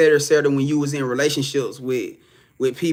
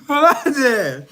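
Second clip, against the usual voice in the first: laughing; 9 semitones higher, 4 dB louder, slower than usual. Real, not acted. Laughing reaction to chat.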